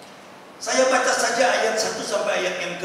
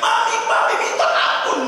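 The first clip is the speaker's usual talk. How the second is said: mock cry for mercy; 9 dB louder than usual. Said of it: "suaminya berteriak".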